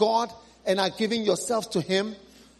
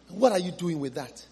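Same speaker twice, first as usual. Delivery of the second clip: usual speaking voice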